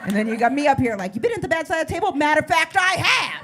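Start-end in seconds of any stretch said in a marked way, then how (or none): 1.1-3.4 fast, high-pitched hammed-up retort; mock indignant outburst. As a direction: build to a high, mock-triumphant declaration. Acted outraged reply about the table.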